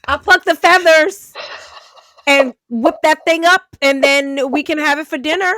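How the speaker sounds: loud, high, laughing run of excited talk; laughing excitedly. Real, not acted. Friend riffing on stealing chickens, laughing loudly.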